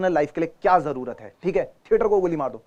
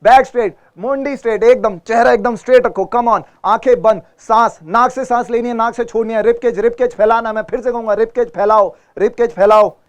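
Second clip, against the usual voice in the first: coaching call; 5 semitones higher, 7 dB louder than usual. Real, not acted. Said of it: "coaching posture and breathing".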